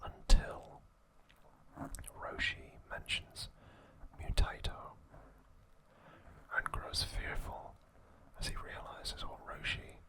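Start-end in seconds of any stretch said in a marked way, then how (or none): none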